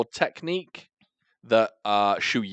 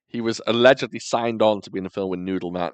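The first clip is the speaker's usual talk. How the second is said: usual speaking voice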